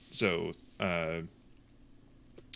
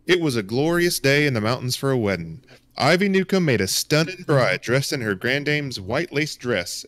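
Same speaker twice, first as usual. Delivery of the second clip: loud Southern storyteller, lilting higher; southern accent; 4 semitones higher, 8 dB louder than usual. Acted. Game master sets the wedding scene for the players, Southern accent. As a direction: read it out big in a Southern accent. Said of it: "nice southern accent to get the".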